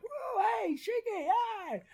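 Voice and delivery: high falsetto catcall; squeaky mock hollering at her; guy catcalling. Passer-by hollering at the widow, high falsetto. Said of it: "this guy's like".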